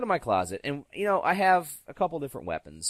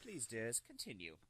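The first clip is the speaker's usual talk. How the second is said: much softer, gentle mock permission; gracious old lady; 2 semitones lower, 18 dB quieter than usual. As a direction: grant it softly, like a sweet grandma. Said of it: "she's just like".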